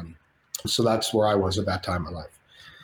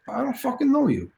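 much higher put-on De Niro voice; hammed-up De Niro calling out; De Niro impression; 13 semitones higher than usual. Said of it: "Robert De Niro goes by Bobby".

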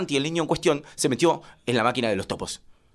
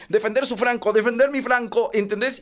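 usual speaking voice

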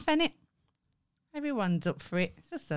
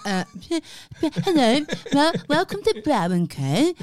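mock awkward voiceover; 4 semitones higher, 5 dB louder than usual. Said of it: "I sounded like a dickhead".

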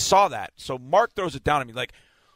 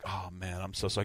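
much softer, lower apology; sheepish apology; 6 semitones lower, 11 dB quieter than usual. Retold or imitated. Reporter apologizing to lineman for celebrating, hushed. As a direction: drop it almost under your breath, embarrassed. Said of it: "I was like".

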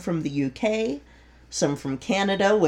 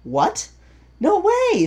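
surprised; 14 semitones higher, 4 dB louder than usual. Retold or imitated. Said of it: "just going".

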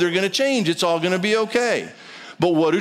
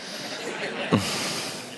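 soft breathy chuckle; a brief unvoiced laugh at his own joke; low breathy laugh to himself; quiet laugh; 11 semitones lower, 9 dB quieter than usual. Real, not acted.

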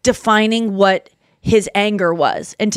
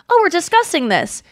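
higher-pitched polite discussion voice; mock civil discussion; 7 semitones higher than usual. Contrasting a book discussion with a fight, mock civil. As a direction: act out a civil book-club chat. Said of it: "like, a book discussion".

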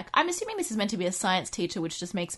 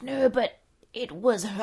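slow, louder aunt pointing blame; 4 semitones higher, 5 dB louder, slower than usual. Retold or imitated. Aunt blames the cat, slow and a little louder. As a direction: drag it out, shifting blame. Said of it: "she's like".

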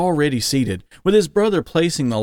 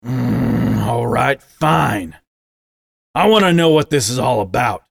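a growl before the words; growling father; 3 dB louder than usual. A narrator's reading. Father finally confronting his son, gruff. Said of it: "then growled".

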